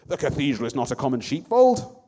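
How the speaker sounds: priest's shocked angry cry; angry outcry. A narrator's reading. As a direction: scold them for bringing sheep in. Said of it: "a priest cried out angrily".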